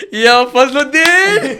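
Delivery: loud, high, drawn-out holler; whooping, slowed-out cheer; excited shout. Retold or imitated. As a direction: re-enact a thrilled yell, stretching the name. Acts out his excited exclamation back then.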